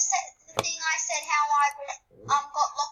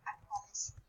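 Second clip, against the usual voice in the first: whisper; 7 semitones lower, 16 dB quieter than usual. Real, not acted. Hushed reassurance.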